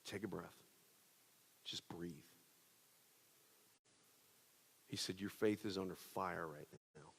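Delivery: soft, low, steady reassurance; quiet comfort from a fellow preacher; calm friend. Retold or imitated. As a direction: talk him down gently. Friend's calm counsel on phone.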